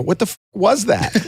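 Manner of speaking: a high startled what-was-that; baffled. Retold or imitated. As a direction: ask it like you can't believe it. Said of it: "I go".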